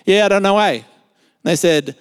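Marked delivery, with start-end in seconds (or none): 0.0-0.7 "Yeah, I don't know, eh" slurred into one word; "Yeah, I don't know, eh" as one Australian mumble-word; sentence as one word. Retold or imitated. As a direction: run "Yeah, I don't know, eh" together, broad Aussie. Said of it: "we make it a word".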